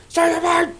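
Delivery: high, strained mock cry; Indiana Jones. Hero still running, high strained mock cry. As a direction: call out in put-on panic. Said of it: "he's just running".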